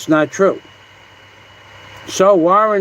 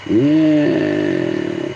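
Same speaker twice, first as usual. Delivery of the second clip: deep drawn-out yeah. Acted.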